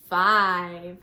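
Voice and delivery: ordinary talk, nothing marked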